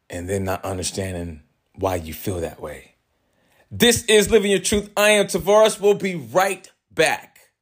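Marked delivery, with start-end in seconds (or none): 3.7-7.5 higher upbeat announcer voice; radio host voice. Acted. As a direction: sign off for the break like a DJ. Show break announcement.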